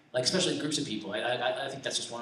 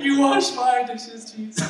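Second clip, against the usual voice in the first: high, slow, hammed-up wail; mock crying; 9 semitones higher, 6 dB louder, slower than usual. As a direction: draw it out as a comic tearful cry. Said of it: "I'm like, crying".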